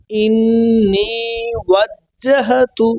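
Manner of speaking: ইন্নি ওয়াজ্জাহাতু slowly, with long held vowels; correct pronunciation. Shown as a demonstration. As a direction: recite ইন্নি ওয়াজ্জাহাতু drawn out for learners to repeat. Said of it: "উচ্চারণগুলো সহি হয়".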